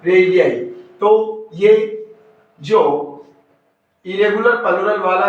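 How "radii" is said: रेडियाई said "RAY-dee-eye"; correct pronunciation. Shown as a demonstration. Teacher giving students the right plural, pronounced "ray-dee-eye". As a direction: say रेडियाई with a full final "eye". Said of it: "क्या बोला जाएगा".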